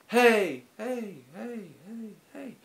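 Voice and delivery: high calls fading out like an echo; echo delay demo. Shown as a demonstration. Showing rappers what delay sounds like, high fading echo. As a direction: imitate a delay echo trailing off. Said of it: "delay is pretty much if I was like".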